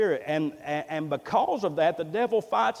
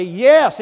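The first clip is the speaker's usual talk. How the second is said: YES punched loud and higher; emphatic yes; 4 semitones higher, 12 dB louder than usual. Retold or imitated. Convert answers the call to be saved, emphatic. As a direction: hit "Yes" hard, as an eager convert would.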